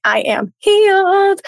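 high, slow, grandly drawn-out declaration; mock triumphant. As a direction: announce it like a fake miracle cure. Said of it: "you're like".

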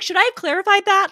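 high, loud quoted question; mock earnest question. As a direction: pipe up with an eager question. Acting out her anxious question.

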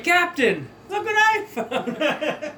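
excited shout. Acted. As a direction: call out proudly to the captain. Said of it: "And he's like".

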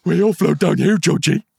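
high, loud squeaky creepy whisper; cartoon dog. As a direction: Pennywise line in a yappy cartoon dog voice. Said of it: "that sounded like a cartoon dog".

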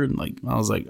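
usual speaking voice

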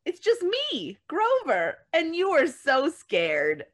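high, slow, sheepish little monster; Grover voice. Retold or imitated. Parent reading the book's ending as Grover, high and sheepish. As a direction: coo it out like a bashful Muppet.

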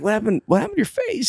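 startled question. Retold or imitated. Acted startled question about a face.

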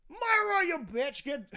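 loud, high mock-British scolding; British accent attempt. Podcaster playing someone ordering Myra about, mock British accent. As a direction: yell at Myra in hammed-up British. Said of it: "I used to be so good".

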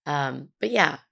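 usual speaking voice

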